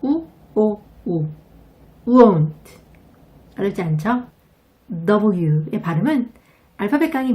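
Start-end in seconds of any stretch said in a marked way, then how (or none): 0.0-1.3 "우, 오, 우" said as three separate vowels; teacher breaks won't into 우 오 우; three vowels split. Shown as a demonstration. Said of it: "모음이 세 개 들어가 있다고". 2.1-2.8 "won't" said 우오운트 with a 우 onset and falling tune; loud teacher model of won't; won't as uont. Shown as a demonstration. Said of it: "모음이 세 개".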